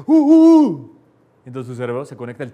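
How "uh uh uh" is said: very high, very loud shout; shouted grunts. Shown as a demonstration. Teacher contrasts two ways of saying the same grunt. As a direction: yell it with full force. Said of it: "a que diga".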